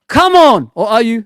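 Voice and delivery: loud, high taunt; mock challenge. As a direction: shout it like a playful dare. Challenging Rohan.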